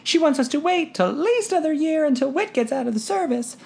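put-on high girlish voice, hushed telling; tiny whisper. A narrator's reading. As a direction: breathe it into his ear. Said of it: "Her tiny whisper tickled my ear".

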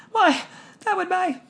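put-on high, astonished girl's voice; a young daughter who can't believe the sum; incredulous. A narrator's reading. Daughter to her father about the money, high and incredulous. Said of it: "Trixie said incredulously".

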